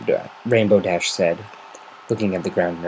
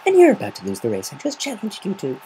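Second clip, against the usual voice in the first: higher, playful taunt; teasing; 11 semitones higher than usual. Acted. Gilda teasing Rainbow before racing off. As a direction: tease her into a race. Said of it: "Gilda teased".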